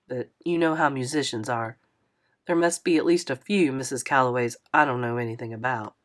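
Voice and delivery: put-on an amused old musician, unhurried; easygoing old man. A narrator's reading. Narrator voices Lefty Lewis.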